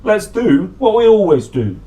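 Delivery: cheerful Slobby Dog. Acted. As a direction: suggest it brightly as the dog. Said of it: "said Slobby Dog".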